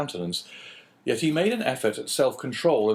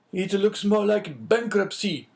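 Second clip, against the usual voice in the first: higher, slow, a touch of despair; despairing exclamation; 8 semitones higher than usual. A narrator's reading. Inspector to the Englishman, despairing and high. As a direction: cry out as the debt slips away, higher and slow. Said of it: "exclaimed Monsieur de Beauville despairingly".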